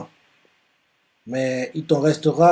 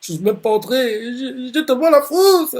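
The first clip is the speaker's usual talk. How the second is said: high, shaky scared voice rising louder; mock panicked inner voice, much higher; tearful fright; 10 semitones higher, 3 dB louder than usual. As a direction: whimper it like a terrified kid. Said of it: "il commence à pleurer".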